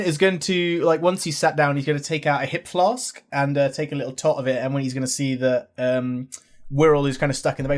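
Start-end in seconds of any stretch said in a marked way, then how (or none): none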